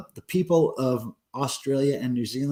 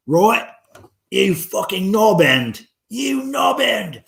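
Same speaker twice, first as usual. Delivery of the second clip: hammed-up Cockney, loud and slow; mock Cockney; 7 semitones higher, 6 dB louder, slower than usual. Streamer shows off his Cockney accent to a viewer, loud mock Cockney. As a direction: bark it like a mock Cockney geezer. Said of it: "Almost a Cockney accent".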